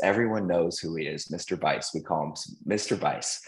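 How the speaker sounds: ordinary talk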